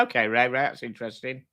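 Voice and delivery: normal talking voice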